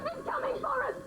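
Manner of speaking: high, panicky alarm; panicked cry. Acted. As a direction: shriek that it's coming. Panicking at a monster.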